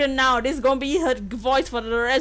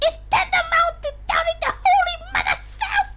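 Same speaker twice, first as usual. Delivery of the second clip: Toad voice audition. Acted. Streamer auditioning the Toad voice for the princess, screeching shout. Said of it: "should she be Toad?".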